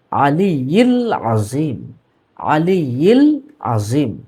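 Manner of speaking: আলিয়্যিল আযীম recited slowly with Arabic sounds, stress on আলিয়্যিল; correct pronunciation. Shown as a demonstration. Teacher giving viewers the correct recitation, slowly. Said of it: "না, শব্দটা".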